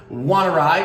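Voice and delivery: snail offering. Acted. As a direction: offer kindly with a lift. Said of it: "asks Snail".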